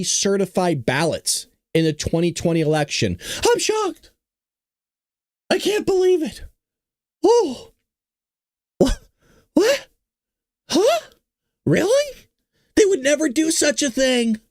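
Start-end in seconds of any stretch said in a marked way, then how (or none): 3.5-6.4 high, deadpan mock shock; sarcastic shock. Host to viewers about the election news: mock shock. 7.2-14.4 high, hammed-up disbelief; mock surprise. Host to viewers about the election news: hammed-up surprise.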